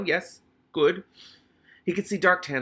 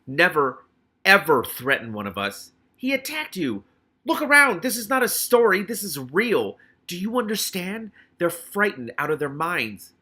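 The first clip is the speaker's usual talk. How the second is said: much higher and louder, outraged; furious rebuke; 6 semitones higher, 7 dB louder than usual. Acted. Keith shouting at Militia never to threaten the rats. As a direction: shout her down, shaking.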